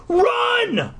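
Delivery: high shouted "Run!"; screamed. A narrator's reading. Girl screams at Eddie to run. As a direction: scream at your brother. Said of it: "I screamed".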